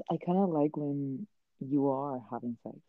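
soft, held-down voice; quiet but clear. Real, not acted. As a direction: keep it low so family won't overhear. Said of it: "I'm talking a little quiet".

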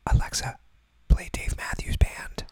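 whispered command. Pretend. Teasing viewers' devices again.

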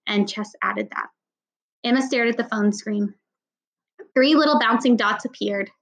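ordinary delivery, nothing marked

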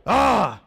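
a loud, high frustrated "Ah!"; angry yell. Retold or imitated. Angry passenger at the desk, loud frustrated yell. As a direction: blow up with a wordless roar. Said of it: "for emphasis".

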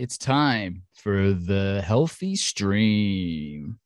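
very slow, drawn-out intro call; mock-grand show opener, long held syllables; show announcer. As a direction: stretch out every word to launch the show. Officially announcing the start of show.

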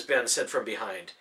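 usual speaking voice

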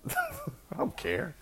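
laughed through, high and slow; slow, high laughing dismissal. Real, not acted. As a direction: shrug it off with a laugh. Laughing off the shaming.

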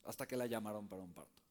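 usual speaking voice

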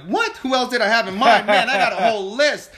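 high, loud and quick, half laughing; loud mock outrage breaking into a laugh; incredulous retort. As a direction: fire back in amused disbelief. Acts out his own incredulous reply.

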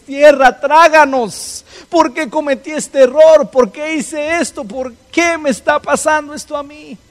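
high, loud anguished cry, slow on tráganos; despairing wail. Acted. Believers crying to the Lord in a problem, high loud lament. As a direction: wail like the ground should swallow you.

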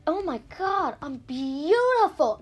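drawn-out self-admiring gush; vain dragon. Acted. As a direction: admire yourself, mock vain. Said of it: "he's like".

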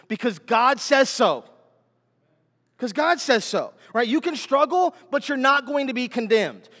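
high, loud, driving sermon cadence; full-force preaching. Real, not acted. Preacher assuring strugglers they are not condemned, high and forceful. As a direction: build each contrast insistently to the congregation.

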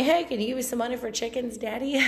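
playful, put-on pleading; wheedling sugar baby. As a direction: sweet-talk someone into handing over money. Wheedling sugar daddy for money.

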